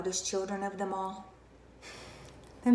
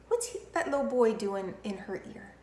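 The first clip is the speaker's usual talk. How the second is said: ordinary talk, nothing marked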